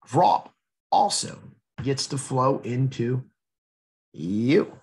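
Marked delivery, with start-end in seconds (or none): none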